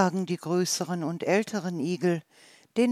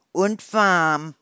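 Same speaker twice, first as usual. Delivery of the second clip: slow, much louder salamander line; salamander explaining; 8 dB louder than usual. Acted. Salamander answers the little hedgehog, slow and loud. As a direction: declare it slowly and boldly.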